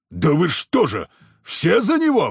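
angry outburst leaping high at the end; indignant roar. A narrator's reading. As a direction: roar in disbelief at all of them. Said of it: "взревел Никодим". (возмущённый рёв)

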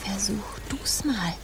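slow hushed whisper; whispered dare. Acted. Urging a companion to tickle him, whispered. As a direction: coax them to try, quietly.

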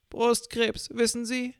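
put-on high whiny old woman; old lady voice. A narrator's reading. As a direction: play the self-pitying old lady, high-pitched.

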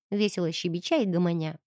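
ordinary delivery, nothing marked

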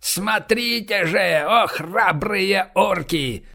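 very slow, high ringing call; solemn proclamation. Acted. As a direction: proclaim it slowly to the crowd. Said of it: "провозгласил он".

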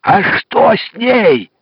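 slow booming question; loud. Acted. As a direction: bellow it to a deaf man. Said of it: "Я загремел во весь голос".

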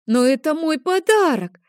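a son protesting his lost present; tearful boy. Acted. Boy protests, tears come. (детский плаксивый протест, громко)

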